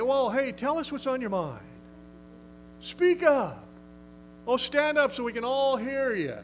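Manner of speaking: a hammed-up, eager, welcoming voice; mock coaxing. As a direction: sarcastically coax the demon to talk. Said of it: "No, he didn't say that".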